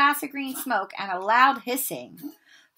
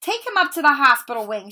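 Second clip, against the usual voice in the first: louder, higher curt order; spat; 5 semitones higher, 7 dB louder than usual. Acted. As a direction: spit out the command. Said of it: "Snape spat at Seamus".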